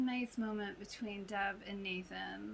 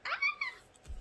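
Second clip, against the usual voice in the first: very high pained squeal; anguished squeal; 4 dB louder than usual. Real, not acted. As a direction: squeal as if it hurts to watch. Gushing, knowing what's coming.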